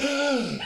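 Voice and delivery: sharp high gasp of shock; startled gasp. Retold or imitated. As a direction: gasp as if jolted awake. Acts out startled look in bed.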